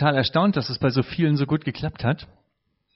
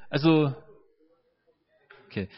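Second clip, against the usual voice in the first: normal talking voice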